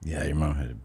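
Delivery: low unvoiced whisper. Real, not acted. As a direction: keep it secret and hushed. Whispered reply continues joke.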